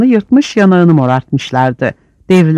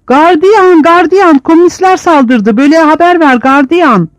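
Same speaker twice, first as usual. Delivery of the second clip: very high, loud desperate calls; calling for the guard; 12 semitones higher, 4 dB louder than usual. Acted. A prisoner screams for the guard, very high and loud.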